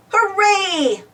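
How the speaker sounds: cheering. A narrator's reading. Machines celebrating lights in the tunnel, excited cheer. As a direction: cheer it with a lift. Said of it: "the machines cried".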